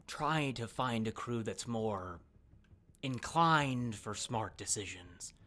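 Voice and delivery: slow, low, deliberate delivery; measured crime-boss advice. Acted. Crime boss advising a released captive, slow and deliberate. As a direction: draw out the advice, letting it sink down. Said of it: "she looks to you and goes".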